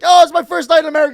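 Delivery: high, loud, fast mock excitement; imitated giddy visitor; thrilled newcomer. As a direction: gush like an overjoyed tourist.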